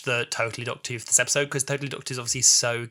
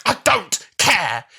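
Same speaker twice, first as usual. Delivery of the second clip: mock defiance; 6 semitones higher, 7 dB louder, much slower than usual. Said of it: "you believe it".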